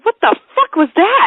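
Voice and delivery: high, startled outburst; shocked guy. Retold or imitated. Guy turning around at her laugh, startled high outburst. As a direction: blurt it out, shocked. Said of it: "turns around and goes".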